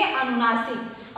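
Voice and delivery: ordinary talk, nothing marked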